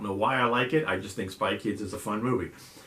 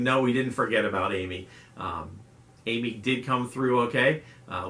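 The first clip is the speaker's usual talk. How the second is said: usual speaking voice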